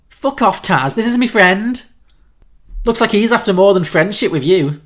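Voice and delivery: put-on loud, high rough Scouse girl; chavvy Scouse accent. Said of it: "in a chavvy accent".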